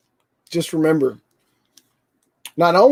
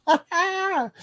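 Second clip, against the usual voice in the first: laughing; 14 semitones higher, 5 dB quieter than usual. Real, not acted. Laughing at his own joke.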